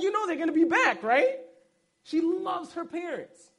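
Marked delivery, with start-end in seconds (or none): 0.0-1.4 quick, higher, louder playful ribbing; teasing wife. Retold or imitated. Husband teasing his crying wife about her parents, quick and playful. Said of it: "I'm like".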